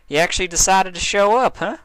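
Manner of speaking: high, loud playful ribbing; teasing Applejack. A narrator's reading. Applejack teasing Dash for showing up. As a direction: needle her with a grin.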